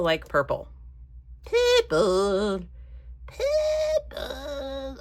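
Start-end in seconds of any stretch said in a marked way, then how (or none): 1.4-2.6 loud, drawn-out silly take on one word; strange made-up voice. Pretend. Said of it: "makes up strange voices to say words". 3.3-5.0 very high, long drawn-out silly voice; strange squeaky voice. Pretend. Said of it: "makes up strange voices to say words".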